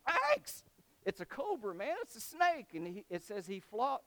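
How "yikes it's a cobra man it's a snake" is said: high, loud yelp of alarm; hammed-up panic at seeing a snake; mock fright. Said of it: "because he was scared".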